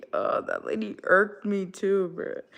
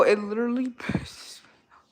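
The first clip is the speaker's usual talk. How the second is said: laughing whisper. Real, not acted. Ranting, drops to laughing whisper.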